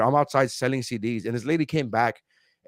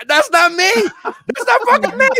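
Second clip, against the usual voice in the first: much higher, louder mock outrage; indignant retold yell; 6 dB louder than usual. Yelling it's not him to his wife, mock indignant. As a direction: shout the denial like it's absurd. Denying to wife about photo.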